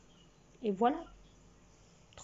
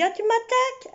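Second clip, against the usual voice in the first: mock indignant; 10 semitones higher, 4 dB louder than usual. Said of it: "J'ai dit".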